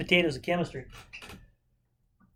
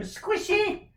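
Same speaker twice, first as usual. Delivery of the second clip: squeaky squishy. Real, not acted. Cramped writing space on board.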